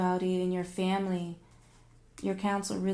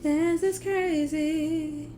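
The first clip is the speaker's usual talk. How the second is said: drawn-out exclaim; 8 semitones higher, 6 dB louder, much slower than usual. Acted. Repeats phrase, second drawn out.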